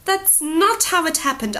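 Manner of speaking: put-on a girl near tears defending herself; close to tears. A narrator's reading. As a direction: hold back tears as you insist. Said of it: "close to tears again".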